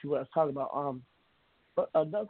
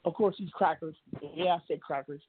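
ordinary talk, nothing marked

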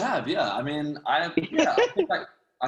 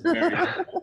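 high, laughing through very; 5 dB louder, slower than usual. Real, not acted. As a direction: laugh the words out, pitched up. Repeats the praise, laughing.

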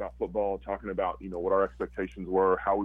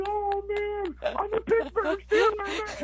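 high, slow, loud, near crying with joy; overjoyed; 3 dB louder, much slower than usual. Retold or imitated. Celebrating being drafted by the Steelers, high tearful shout. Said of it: "I'm like".

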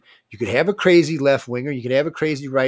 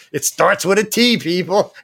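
exasperated shout; 7 semitones higher, 6 dB louder, slower than usual. Retold or imitated. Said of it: "I'm like".

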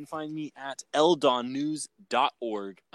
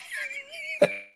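high giggle. Real, not acted. Giggle after botched ad read.

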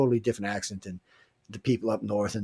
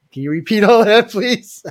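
baffled asking; 10 semitones higher, 12 dB louder than usual. Retold or imitated. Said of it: "I'm like".

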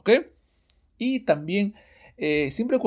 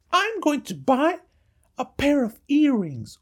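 pitched-up reply voice, slower; high dialogue voice; 12 semitones higher than usual. Shown as a demonstration. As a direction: answer in a high voice. Answer voice in dialogue.

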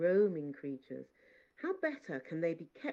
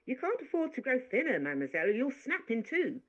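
higher, louder scolding maid; rebuking; 9 semitones higher, 6 dB louder than usual. Acted. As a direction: tell her off like a fussing maid. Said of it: "rebuked me".

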